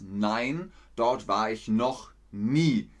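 ordinary delivery

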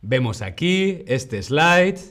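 ordinary delivery

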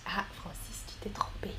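hushed, whispered to a student; whispered aside. Real, not acted. Teacher noticing Francis's mistake, whispered.

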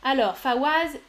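ordinary talk

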